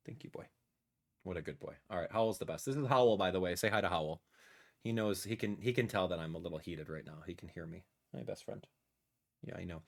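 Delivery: very soft, low murmur; tender pet talk. Real, not acted. Streamer praising his best friend the dog, tender. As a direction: praise your dog in a hushed, low, loving voice.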